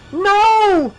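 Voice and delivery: a high, loud, desperate shout; a piercing high scream of no. Acted. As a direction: cry out as they drag you away. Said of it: "she shouted".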